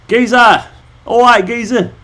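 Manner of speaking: high mock London geezer; London accent. Shown as a demonstration. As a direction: call out in a put-on London accent. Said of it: "London Weaves".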